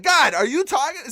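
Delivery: high, loud, drawn-out appeal to God; re-enacted alarm; retold freak-out. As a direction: call upward, freaking out. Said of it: "So I'm freaking out".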